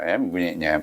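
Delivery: ordinary talk, nothing marked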